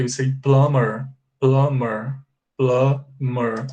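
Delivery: plumber with no B sound, said slowly three times; silent B. Shown as a demonstration. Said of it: "Pay attention to the B here".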